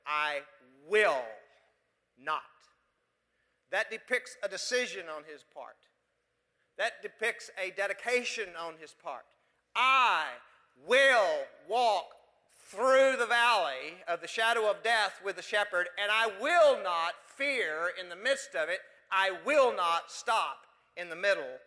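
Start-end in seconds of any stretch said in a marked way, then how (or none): 0.1-2.4 "will" punched loud and drawn out, pause before "not"; stress on "will". Shown as a demonstration. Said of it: "Now, circle the word will". 9.7-21.6 loud, slow, emphatic vow; resolute declaration. Acted. Preacher voices David's determined vow.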